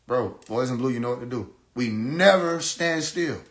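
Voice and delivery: ordinary talk, nothing marked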